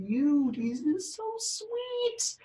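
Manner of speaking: put-on soft, high coo at a sleeping baby; cooing baby talk. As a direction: melt over a cute baby, hushed and sing-song. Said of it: "I'm like (cute little babies)".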